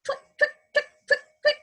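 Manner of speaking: very high, tiny twit sounds; high toenail clicks. A narrator's reading. Mom reads a picture book, high little clicks. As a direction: squeak it like claws tapping. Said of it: "They go".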